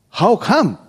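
ordinary delivery

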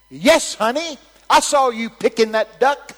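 high, shouted punchline; booming pronouncement. Retold or imitated. God's message for the old lady, booming pronouncement. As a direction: proclaim the odd message at full volume.

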